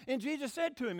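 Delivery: ordinary talk, nothing marked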